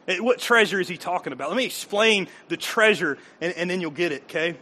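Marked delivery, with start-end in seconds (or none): none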